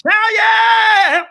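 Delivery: high, loud shout of joy; celebratory yell; triumphant shout. Real, not acted. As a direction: whoop it out at the peak. Climax of the chant.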